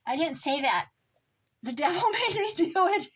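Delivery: high, loud, laughing fake innocence; mock innocent denial. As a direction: play the caught-out churchgoer blaming the devil. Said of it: "you're like".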